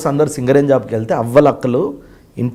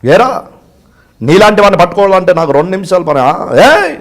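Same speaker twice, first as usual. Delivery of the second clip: loud film-villain threat; Prakash Raj; 2 semitones higher, 6 dB louder than usual. Retold or imitated. Film villain threatening a man he can catch, loud mimicry. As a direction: challenge him, rising to a loud call. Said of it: "అది ఎలా ఉంటుంది అంటే ఇప్పుడు ప్రకాష్ రాజ్ గారిది".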